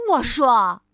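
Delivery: loud cheerful reply; a hint of friendly patience; lightly voiced Mr. Happy. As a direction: keep calm and cheery. Mr. Happy replies.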